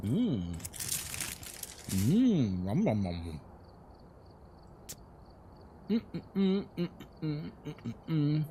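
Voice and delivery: nom and hum through a mouthful; happy eating noises. Real, not acted. Eating a pain au chocolat.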